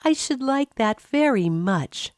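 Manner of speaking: put-on grateful girl. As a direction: thank them gently, a young girl's voice.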